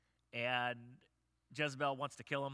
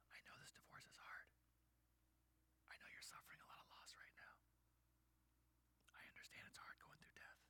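God's whisper; 24 dB quieter than usual. Acted. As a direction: whisper reassurance to someone suffering. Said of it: "he's there whispering".

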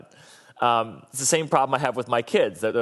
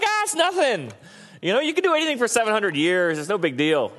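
high, breezy, shrugging; casual God; 9 semitones higher than usual. Retold or imitated. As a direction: wave it away like it's nothing. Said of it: "He's like".

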